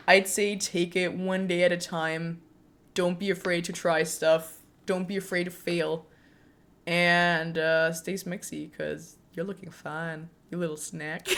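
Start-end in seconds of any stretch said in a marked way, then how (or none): none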